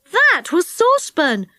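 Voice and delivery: plain reading voice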